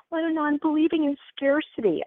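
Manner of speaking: high-pitched, hesitant client voice; worried client. Acted. Client phoning about a scarcity belief, mock worried. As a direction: imitate a caller fretting over a belief. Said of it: "when people call me up".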